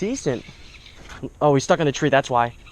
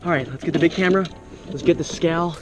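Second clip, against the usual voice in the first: ordinary talk, nothing marked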